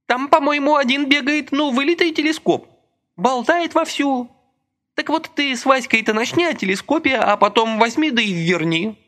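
high, lively plotting voice; scheming Masha. A narrator's reading. Masha advises Lika on a trick, high lively scheming. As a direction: let her hatch a sly plan.

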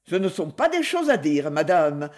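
plain reading voice

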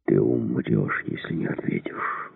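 low, menacing whisper; hissed threat. A narrator's reading. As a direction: hiss it softly as a deadly warning. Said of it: "прошипел Подовский". (угрожающе, злым шипением)

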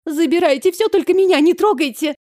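high-pitched panicky begging; frightened plea. A narrator's reading. Elf girl pleading with supposed thieves, frightened high plea. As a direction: beg them in a very high voice. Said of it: "От страха её лицо стало белее мела".

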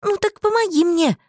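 high, loud demand; urgent high-pitched order. A narrator's reading. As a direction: demand help impatiently, pitch way up. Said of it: "велела я".

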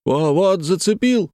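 high, slow-drawn call; high excited holler; sudden cry. A narrator's reading. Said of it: "закричал вдруг кучер".

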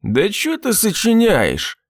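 high, drawn-out indignation; indignant protest. A narrator's reading. Drunk husband denying wife's fear, indignant. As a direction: protest slowly and high, offended.

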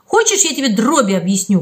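plain reading voice

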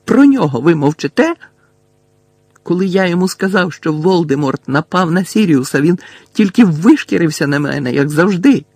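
heated, high and loud; shouting accusation. A narrator's reading. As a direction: hurl the accusation at the listener. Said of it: "крикнув Гаррі".

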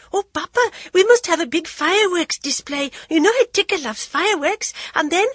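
high, loud, tumbling with delight; gushing like an excited girl; overjoyed. A narrator's reading. Jaya to her father, high breathless excitement.

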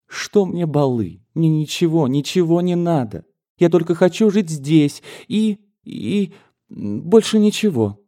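insist, clinging, you want only home; pleading daughter. Acted. A daughter telling her mother she wants to live only here.

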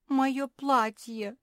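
slow, high, loud wail; drawn-out whine over a loss; whimpering. A narrator's reading. Naretta grieving for her dress, whimpering. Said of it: "захныкала Наретта".

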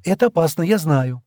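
plain reading voice

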